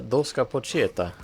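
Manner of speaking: rolling out a name like an Italian; spontaneous Italian accent. Pretend. Streamer blurts a sign name, mock Italian accent. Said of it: "I said that in a Italian accent".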